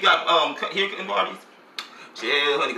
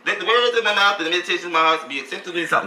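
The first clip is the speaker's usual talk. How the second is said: quick, lightly intoned church prayer; mock prayer; 3 semitones higher, faster than usual. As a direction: rattle off a church prayer, half in jest. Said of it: "stand in the midst of prayer".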